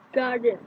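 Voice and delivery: "Garden" with stress on "Gar"; first-syllable stress. Shown as a demonstration. Teacher demonstrating word stress, "Garden" stressed on "Gar". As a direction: lean on "Gar" in "Garden", then fall. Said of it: "The first syllable stress".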